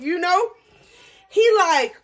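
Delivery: usual speaking voice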